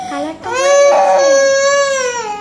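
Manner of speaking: loud, high, drawn-out wail; real tearful protest; crying. Real, not acted. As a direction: wail the words out slowly, crying. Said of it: "তাও কাঁদছে".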